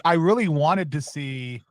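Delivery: ordinary talk, nothing marked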